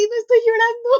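high, loud insistent denial; defensive denial. Retold or imitated. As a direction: deny it loudly, a bit tearful. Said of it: "Y así". (negación a la defensiva, alta y fuerte)

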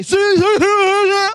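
loud, high mocking shout; gloating taunt. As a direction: rub it in their face. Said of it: "get all up in their face and say".

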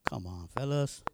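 very low, soft retold reproach; quiet chiding. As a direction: murmur it low, as a weary teacher would. Voicing Jesus chiding forgetful disciples.

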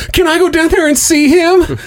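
put-on very high, pleading child voice; little kid voice. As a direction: beg like an excited little kid. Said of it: "in the most little kid voice says".

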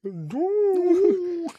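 long, high, loud wail. Real, not acted. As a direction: cry it out in exaggerated protest. Wailed protest in comic banter.

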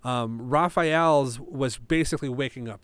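usual speaking voice

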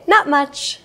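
cheerful and friendly. Shown as a demonstration. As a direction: answer like you're happy to chat. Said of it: "use a cheerful voice as you say".

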